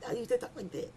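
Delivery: lisping "this" as "thith"; childhood lisp. Shown as a demonstration. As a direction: demo of a tongue-tied child's lisp.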